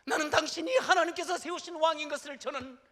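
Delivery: loud, high call across the distance; preacher voicing David's loud cry. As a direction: shout it after the departing king. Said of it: "큰 소리로 소리를 지르면서".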